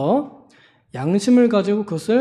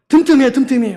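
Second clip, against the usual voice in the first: bossy nagging; 5 semitones higher, 6 dB louder, faster than usual. Retold or imitated. Zealot lecturing neighbor.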